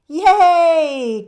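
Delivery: high, very loud cheer. Acted. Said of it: "clapped the cousins".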